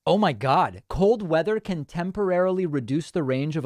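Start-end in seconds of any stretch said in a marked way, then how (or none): none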